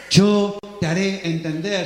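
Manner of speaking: reply calmly as God. Acted. Pero dice Dios.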